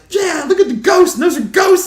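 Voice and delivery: high, loud mock squeal; excited woman imitated. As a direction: shout it in a shrieky thrilled voice. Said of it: "And she was so excited".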